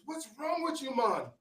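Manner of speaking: high, breezy islander asking what's wrong; a laid-back local checking on a riled guest; easygoing concierge. Retold or imitated. Concierge to the angry traveller, high and breezy. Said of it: "And he says".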